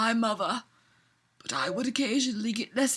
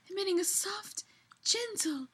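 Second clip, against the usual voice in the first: soft breathy read; 5 semitones higher, 9 dB quieter than usual. Acted. Mock tender description.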